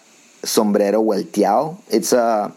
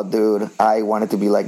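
usual speaking voice

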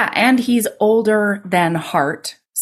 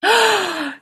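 shocked gasp; 10 semitones higher than usual. Real, not acted. Listener hears who walks in.

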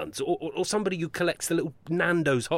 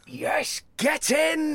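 high, loud victory yell; triumphant cheer; 13 semitones higher, 3 dB louder, much slower than usual. Real, not acted. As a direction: shout it like you just scored. Boss celebrates the share deal.

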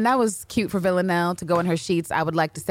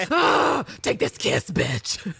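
acted quote; 4 semitones higher, much slower than usual. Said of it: "And Eve was like".

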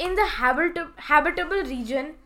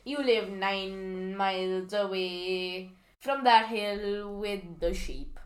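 menacing; 7 semitones lower, slower than usual. Acted. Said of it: "Jeremy Clarkson will find us".

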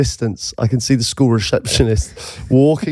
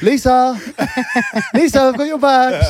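hammed-up shout, pitched way up; calling across a field; 13 semitones higher than usual. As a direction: holler for a pupil from afar, laughing.

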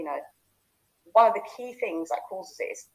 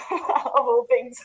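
higher-pitched words through a laugh; amused laughing; 5 semitones higher, 5 dB louder, much slower than usual. Real, not acted. Speaker recalling becoming personal trainer, laughing. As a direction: laugh at your own unlikely choice. Laughs at own career choice.

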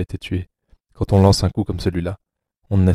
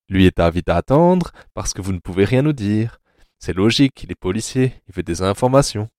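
mock-courteous threat with lively, rising phrases; menacing jovial captor; 2 semitones higher than usual. Anatole speaks into paralysed Rainer's ear, menacingly courteous. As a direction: play a captor politely toying with his prisoner. Anatole addresses the helpless captive.

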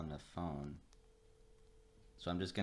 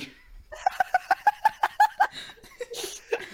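long, high, loud cackling fit; real laughing fit, very loud; helpless laughter; 9 dB louder than usual. Real, not acted. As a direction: crack up uncontrollably. Cracks up rehearsing his opening line.

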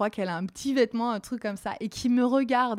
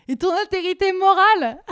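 high, loud sarcastic outcry; indignant jab; 10 semitones higher, 10 dB louder than usual. Retold or imitated. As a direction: throw his morals back at him.

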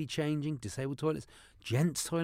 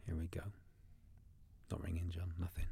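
whispering; 8 semitones lower, 16 dB quieter than usual. Real, not acted. Waiting quietly on the call.

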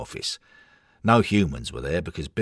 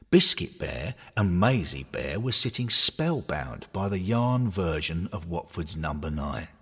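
ordinary delivery, nothing marked

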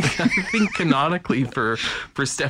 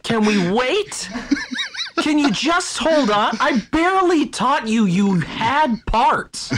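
exasperated God; 7 semitones higher than usual. Retold or imitated. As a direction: beg her to hold off, flustered.

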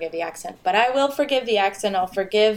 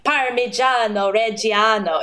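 slow, hammed-up Italian accent; showy, overdone Italian pronunciation; pretentious Italian accent. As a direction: savor each syllable in mock Italian. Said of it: "someone who's not Italian says".

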